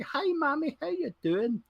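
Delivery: high mock Scottish greeting to mummy; imitating a son talking Scottish to his mum; Scottish accent imitation. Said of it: "when he goes home and he is like".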